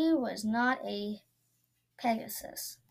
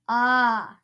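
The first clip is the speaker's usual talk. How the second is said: a louder echoed ah; 8 dB louder than usual. Acted. Princess echoing Duff, loud ah. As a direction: blurt it back.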